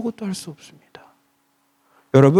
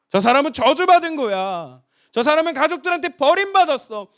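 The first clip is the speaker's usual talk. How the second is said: higher, sneering gossip voice; scornful gossip; 7 semitones higher than usual. Retold or imitated. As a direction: point and sneer at him. 사람들의 손가락질 흉내. (손가락질하며 수군거리는 말투)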